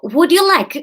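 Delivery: high, loud Spanish shop assistant; Spanish accent, first attempt. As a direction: try a Spanish accent on the line. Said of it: "Let's go Spanish".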